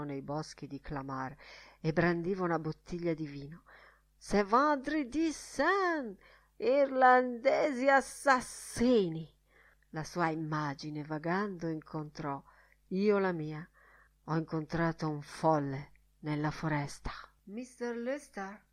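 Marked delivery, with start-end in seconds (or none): 4.2-9.3 high, drawn-out accusing cry; wild, slow outcry in a raised pitch; declaimed cry. Acted. Wild man brandishes bottle, cries out. 17.5-18.6 slow, hushed whispered query; softly whispered call for attention; discreet whisper. Acted. Attendant calls softly from ajar door.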